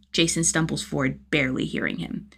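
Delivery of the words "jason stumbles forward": usual speaking voice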